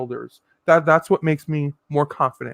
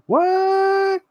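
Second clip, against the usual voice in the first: shocked; 13 semitones higher than usual. Real, not acted. Reacts to a shocking comment.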